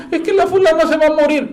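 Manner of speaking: high whiny child's voice, imitating a weepy kid; crying little boy. Little Pepito tells his mother in a high, tearful child's voice. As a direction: raise the pitch and whimper it like a small boy. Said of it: "llega llorando y le dice a la mamá".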